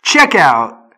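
loud announcer call. Acted. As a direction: boom it like a radio spot. Starting the sponsor ad read.

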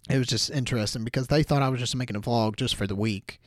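usual speaking voice

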